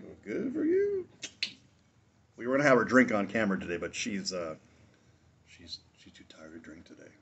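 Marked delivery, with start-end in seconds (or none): 0.0-1.1 high, slow, sarcastic falsetto; sing-song it way up high, mocking; mock sarcastic reply. Answering his tipsy wife in a high, slow mock voice. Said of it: "I'm like".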